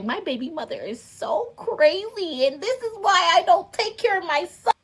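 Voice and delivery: high mock whine of the ex. Mocking her son's father, high and whiny. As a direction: sneer his excuse back at him. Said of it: "put up this facade like".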